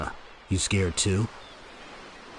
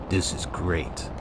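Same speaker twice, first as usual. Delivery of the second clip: lower, softer brooding "This is great."; low deadpan voice; 6 semitones lower, 7 dB quieter, slower than usual. Shown as a demonstration. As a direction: redo it low and quiet, deadpan.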